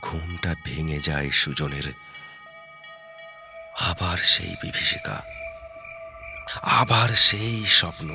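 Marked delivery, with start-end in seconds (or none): none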